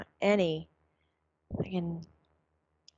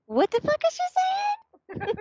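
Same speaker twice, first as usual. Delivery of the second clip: squeaky, much higher, laughing through it; 4 dB louder than usual. Shown as a demonstration. Mother trying a silly high voice, laughing. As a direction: push the voice way up high. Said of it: "can get my voice that high".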